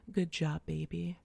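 usual speaking voice

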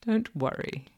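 plain reading voice

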